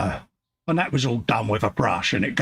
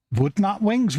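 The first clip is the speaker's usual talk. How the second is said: high questioning "Wood Nut Wings?"; surprised; 9 semitones higher than usual. Real, not acted. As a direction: catch the slip, rising in surprise. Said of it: "Wing Nut Wings".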